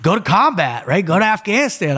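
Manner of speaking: acted-out excited recruiting; eager urging. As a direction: sell a friend on the big chance. Buddy urging him to join platoon.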